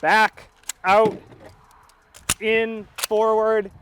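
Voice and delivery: loud, clipped range commands, slowing down; calling out commands. Real, not acted. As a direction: bark each step out to the range. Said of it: "you call out the commands".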